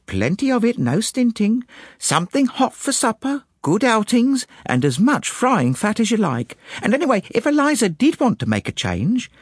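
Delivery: put-on high, lively servant-girl gossip; chatty maid, confiding. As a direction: list the perks of the job brightly. Voices Annie gossiping about her employer.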